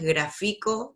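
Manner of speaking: gráfico said as gra-FI-co; wrong middle stress. Shown as a demonstration. Teacher showing what the word is not, middle stress on gráfico. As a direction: move the punch to "fi" in gráfico. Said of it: "No es gráfico ni gráfico".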